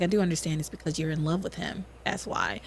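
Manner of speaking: low, soft mutter trailing away; muttered under breath. Retold or imitated. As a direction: retort muttered to oneself, imitating him. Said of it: "Under his breath, he's like".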